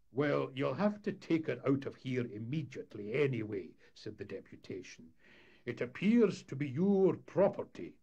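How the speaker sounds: a lightly voiced official pressing; stern townsman. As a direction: deputation insisting the whale go. Deputation demanding action.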